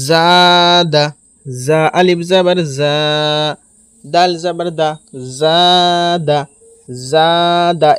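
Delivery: জা held long, দা cut short; long first syllable. Shown as a demonstration. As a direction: draw out জা in জাদা, then a brief দা. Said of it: "এই কথাতে টান হবে".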